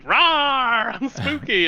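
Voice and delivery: high playful monster roar; dinosaur voice. Pretend. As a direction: rawr it out like a toy dinosaur. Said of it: "I'm like".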